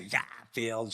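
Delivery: soft Boston "Garfield", dropped r; Boston accent. Retold or imitated. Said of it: "She would talk in that Boston accent".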